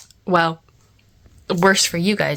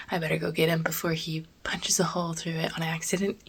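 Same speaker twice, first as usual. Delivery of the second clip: whispering; 3 semitones lower, 8 dB quieter, faster than usual. Real, not acted. Son sleeping nearby, whispering.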